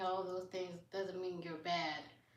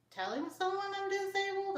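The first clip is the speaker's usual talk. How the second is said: surprised; 12 semitones higher than usual. Real, not acted. Streamer reacting to a viewer's comment, surprised and high. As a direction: voice leaps up high in disbelief.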